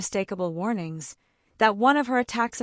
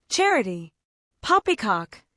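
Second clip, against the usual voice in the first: louder scornful dismissal; scornful snort; 3 semitones higher, 6 dB louder than usual. A narrator's reading. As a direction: snort it off with contempt. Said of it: "snorted Uncle Benjamin".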